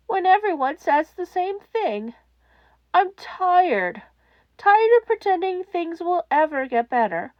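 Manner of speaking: tired of everything, voice breaking; despairing child. A narrator's reading. Child caller pours out exhaustion to the hotline host, despairing.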